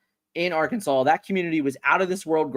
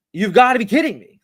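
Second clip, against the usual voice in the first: louder, higher exasperated repeat; replayed outrage at the airline losing the bag; 5 semitones higher, 6 dB louder than usual. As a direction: raise it in frustration. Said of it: "oh, it's frustrating".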